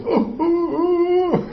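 high, whimpering oh oh oh; hammed-up high wounded moan; mock whimper. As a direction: whine like someone hurt by a tiny insult. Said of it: "y tú eres".

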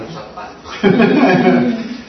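bright high burst of laughter. Real, not acted. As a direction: laugh at the silly idea. Laughs at absurd father. (risa aguda y divertida)

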